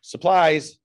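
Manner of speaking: telling a dad joke. Acted. Punchline to janitor joke.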